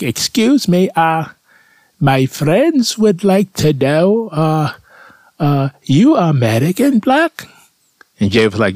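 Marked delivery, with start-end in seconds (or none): 0.0-7.5 much higher, slow, timid German woman; a shy, high, halting foreign question. Retold or imitated. A German village woman timidly asks Jay a question, high and slow. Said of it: "and it's like".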